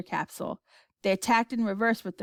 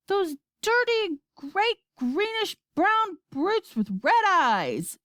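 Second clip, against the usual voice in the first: high, slow, emphatic outburst; shouting; 11 semitones higher, 3 dB louder, much slower than usual. A narrator's reading. Astronaut butting in to describe the brutes, shouting. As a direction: shout each word out, drawn out. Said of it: "shouted Shanks, butting in".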